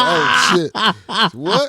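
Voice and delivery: startled wake-up. Retold or imitated. Telling a friend how he wakes from dreams, startled yelp. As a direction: jump awake, alarmed and confused.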